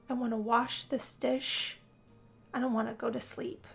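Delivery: usual speaking voice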